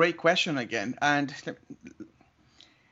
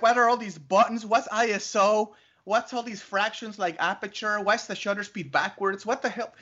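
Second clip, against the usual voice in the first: higher, flustered run of questions; overwhelmed; 6 semitones higher than usual. Retold or imitated. Teacher overwhelmed by the new cameras, flustered. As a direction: pile up the confused questions.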